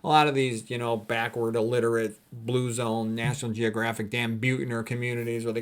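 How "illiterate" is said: ordinary talk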